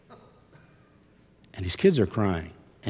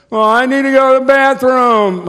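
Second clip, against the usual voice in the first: put-on whiny kid; 13 semitones higher, 10 dB louder than usual. Kid asking in the store.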